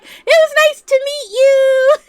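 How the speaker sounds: very high, loud sing-song farewell; hammed-up sweet goodbye to get away; mock chirpy dismissal at full pitch; fake-polite brush-off. Mimics sister's escape line.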